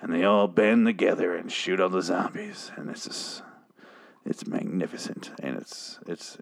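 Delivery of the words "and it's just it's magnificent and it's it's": hushed, breathless whisper of awe; real awestruck whisper; awed whisper. Real, not acted. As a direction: whisper it like you can't believe how good it was. Awed at the zombie battle scene.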